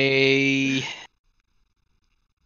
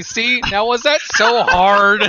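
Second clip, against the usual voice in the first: smug scholar; 6 semitones higher, 5 dB louder, slower than usual. Acted. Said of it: "nice and scholarly again".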